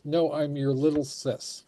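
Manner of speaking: usual speaking voice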